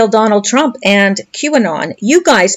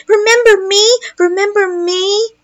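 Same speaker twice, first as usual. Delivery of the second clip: put-on much higher pleading kid voice; high whining little boy; whiny needy child; 10 semitones higher than usual. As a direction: beg for attention like a spoiled boy. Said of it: "Pay attention to me".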